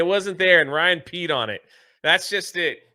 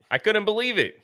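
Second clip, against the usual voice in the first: ordinary talk, nothing marked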